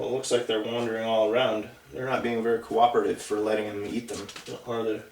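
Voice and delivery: plain reading voice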